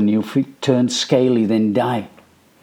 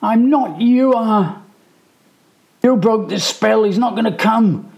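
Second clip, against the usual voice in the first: put-on high, loud boyish protest; angry retort; 10 semitones higher, 4 dB louder than usual. A narrator's reading. Michael shouts back at Danny, angry and scared. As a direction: shout back, blaming him. Boys quarrelling in dark.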